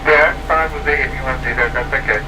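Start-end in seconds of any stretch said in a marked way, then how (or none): none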